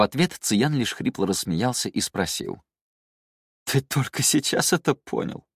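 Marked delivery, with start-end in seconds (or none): 3.6-5.5 higher, faintly sorrowful question; touch of sadness. A narrator's reading. Drunk man answering his companion, a touch of sadness. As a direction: ask it with understated sadness. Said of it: "В его голосе слышалась грусть".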